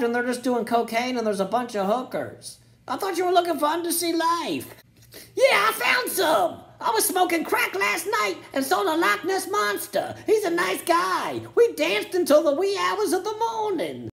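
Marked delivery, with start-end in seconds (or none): 2.9-4.7 whiny mock complaint. Billionaire confronting scientists. 5.3-14.1 hammed-up stoner scientist. Scientist answers billionaire, hammed-up.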